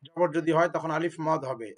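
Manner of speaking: ordinary talk, nothing marked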